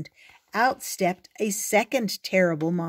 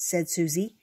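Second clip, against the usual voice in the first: ordinary delivery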